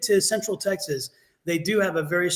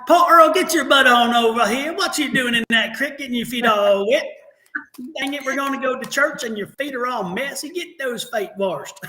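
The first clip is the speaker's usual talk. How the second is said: loud West Virginia holler, slowing at the end; playful West Virginia country accent; 5 semitones higher, 3 dB louder than usual. Acted. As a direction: scold the kids in a thick West Virginia twang. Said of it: "I came from family in West Virginia".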